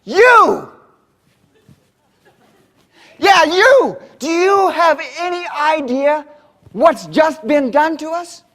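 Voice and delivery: loud, high furious pointing at the speaker; angry accusation. Retold or imitated. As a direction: accuse him loudly, full of outrage. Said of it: "He points at me and he says".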